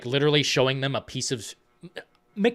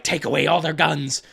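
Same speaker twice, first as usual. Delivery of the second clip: barked order; 4 semitones higher, 6 dB louder than usual. Retold or imitated. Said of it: "he's like".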